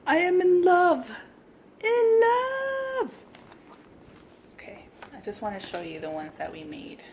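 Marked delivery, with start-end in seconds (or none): 0.1-3.1 much higher and louder, squealed; gushing squeal. Real, not acted. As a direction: squeal it out, smitten. Gushing over craft.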